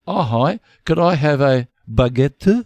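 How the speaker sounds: put-on playful French accent; mock French accent. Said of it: "if I put on an accent".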